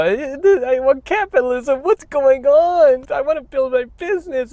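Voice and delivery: mock whiny capitalist. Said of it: "and go".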